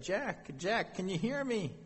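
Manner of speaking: high, gentle call from afar; mock calling to someone out of sight; high-pitched acted hail, not shouted. Voice from above calls to Jack.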